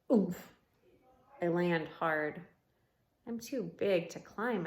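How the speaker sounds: usual speaking voice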